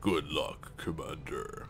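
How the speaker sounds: second try at a gravelly spokesman voice; imitating the spokesman. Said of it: "I would need more of, like, a gravelly voice".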